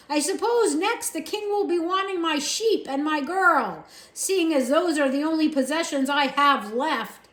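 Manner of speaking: bitter rant. A narrator's reading. Uncle ranting bitterly at the soldier, loud. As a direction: spit out the injustice.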